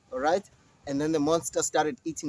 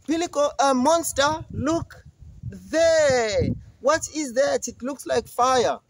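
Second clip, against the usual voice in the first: much higher, excited trick; goat voice; 8 semitones higher than usual. Acted. Middle goat distracts the monster, much higher and alarmed. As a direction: point away in mock alarm. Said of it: "the middle billy goat said".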